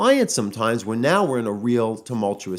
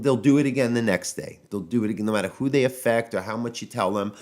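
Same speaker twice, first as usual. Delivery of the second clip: ordinary talk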